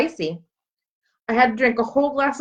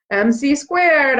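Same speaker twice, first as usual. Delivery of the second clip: slow, proud answer; 7 semitones higher, 5 dB louder, much slower than usual. Acted. Girl answering the Einstein waiter, slow and proud. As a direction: draw each part out slowly, pitched up. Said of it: "I say".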